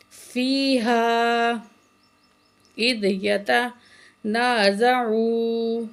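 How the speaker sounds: slow, drawn-out tajweed chanting; Quran recitation. Shown as a demonstration. Teacher recites the verse for students, slow melodic chant. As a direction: recite slowly with full nasal hum.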